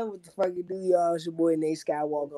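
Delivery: normal talking voice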